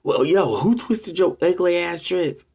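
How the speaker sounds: slow, sneering punchline jab; mocking comeback. Firing back at the troll about their dreads, slow and sneering. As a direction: drag it out to land the insult.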